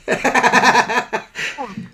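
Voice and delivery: high loud burst of laughter. Real, not acted.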